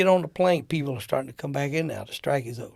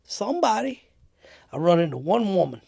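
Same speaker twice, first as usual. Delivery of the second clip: ordinary delivery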